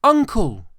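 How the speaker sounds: high, loud plea; pleading. A narrator's reading. Nephew pleading with his uncle, high and urgent. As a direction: beg him to soften. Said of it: "pleaded the nephew".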